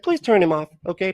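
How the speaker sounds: lightly voiced pleading girlfriend; imitating girlfriend. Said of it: "She's like".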